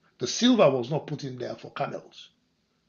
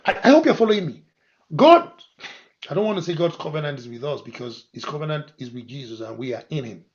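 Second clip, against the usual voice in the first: ordinary talk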